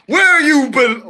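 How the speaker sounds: loud, high, drawn-out decree; hammed-up slow yell; dramatic banishing shout. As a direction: proclaim the banishment theatrically. Banishing the train, first attempt.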